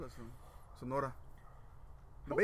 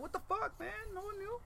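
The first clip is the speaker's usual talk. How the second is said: much higher, disbelieving squeal; shocked high squeak; 5 dB quieter than usual. Retold or imitated. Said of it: "And I was like".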